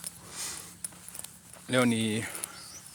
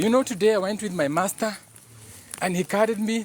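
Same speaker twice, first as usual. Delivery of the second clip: higher bragging dog voice; voicing the dog; 8 semitones higher than usual. Dog brags to stray dogs about being carried, high boastful voice. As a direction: boast to the other dogs like a pampered pet. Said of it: "kupea maumbwa zingine story".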